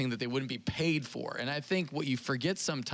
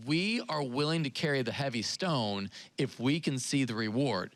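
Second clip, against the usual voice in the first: normal talking voice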